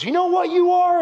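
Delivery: high, loud challenge; retold fired-up coach. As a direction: imitate a coach grabbing a player's attention. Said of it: "he was going all fired up".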